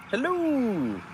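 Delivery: high, falling hello then goodbye; high drawn-out mock-royal calls; singsong greeting. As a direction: wave like the queen to traffic. Waving to traffic.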